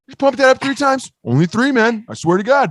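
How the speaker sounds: mock rule-maker. Mocking the BB gun rule-maker.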